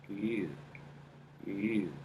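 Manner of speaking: soft ew placed in the upper nose; tiny disgusted ew from the upper nasal; small nasal ew. Shown as a demonstration. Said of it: "a small of a ew".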